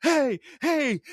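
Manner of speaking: high-pitched mock yell; imitated yelling. Host retelling Roy's cheer in the battle, mock yell. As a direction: chant it up high like an excited trainer. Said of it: "Roy's yelling".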